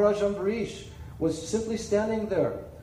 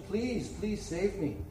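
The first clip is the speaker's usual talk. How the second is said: much softer, slow desperate plea; quiet begging; 8 dB quieter, slower than usual. Acted. Rishi at Vishnu's feet, softly begging to be saved. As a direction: plead in a hushed, broken voice. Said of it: "And he begged Lord Vishnu".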